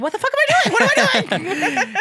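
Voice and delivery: high, loud laughing disbelief; high laughing panic. Retold or imitated. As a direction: laugh at yourself in alarm. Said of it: "I'm like".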